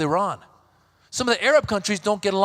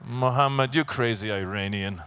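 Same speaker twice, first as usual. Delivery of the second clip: low, much softer tsk-tsk anchor; disapproving anchor; 7 semitones lower, 4 dB quieter than usual. Retold or imitated. Anchor scolding Muhammad after cookie bomb, low quiet disapproval. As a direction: shake your head at Muhammad, low and quiet.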